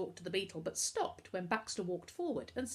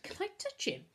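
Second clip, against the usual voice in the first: high, eager request; delighted; 14 semitones higher than usual. A narrator's reading. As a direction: ask permission with delight. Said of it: "Novak laughed with delight".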